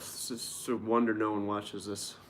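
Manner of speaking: normal talking voice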